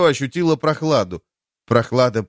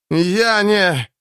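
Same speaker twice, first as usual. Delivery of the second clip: coughing up water; 7 semitones higher than usual. Acted. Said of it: "выдавил Эрвин, закашлялся, выхаркнул воду".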